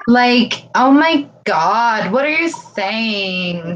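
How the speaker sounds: mock Valley Girl, slow and dramatic. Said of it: "I love that Valley Girl imitation".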